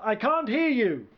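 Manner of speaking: high, thin call from afar; small faraway voice; distant reply. A narrator's reading. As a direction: call back faintly across a field. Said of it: "came the distant reply".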